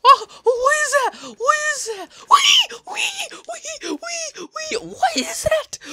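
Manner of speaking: very high shrieks of what is that, ending in a whisper; terrified shrieking. Real, not acted. Gamer sees a strange monster, shrieking in terror. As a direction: freak out at the monster.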